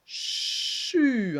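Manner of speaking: "chu" with long SH gliding into the vowel; hiss, then voice the U in "chu"; blended syllable. Shown as a demonstration. Teacher blending two letters for pupils, drawn-out syllable. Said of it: "on va faire d'abord ch".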